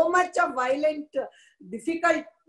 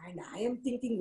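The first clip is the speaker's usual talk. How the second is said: usual speaking voice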